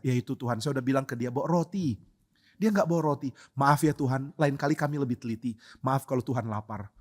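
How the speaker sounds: quiet, apologetic disciple voice; meek soft answer. Acted. Disciples apologizing to Jesus, meek and quiet. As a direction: mumble the excuse timidly.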